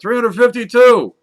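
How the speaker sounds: shouting the number out high and loud; excited blurt. Acted. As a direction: blurt it out, thrilled to guess. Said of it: "She blurts out".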